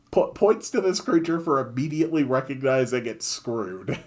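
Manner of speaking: ordinary delivery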